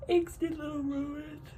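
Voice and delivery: words stretched by a yawn. Real, not acted. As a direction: talk through a yawn. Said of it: "Sorry I keep yawning".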